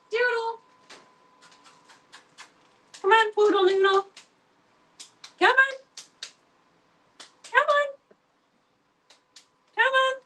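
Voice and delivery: coaxing the cat in a very high sing-song voice; high pet call. Real, not acted. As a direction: call sweetly and high, like to a kitten. Calling the cat into the room.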